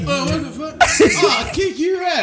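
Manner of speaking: high, loud burst with laughter; mock drunk outrage. Imitating the drunk after each knockout, mock outraged. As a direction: come to swinging, played for laughs.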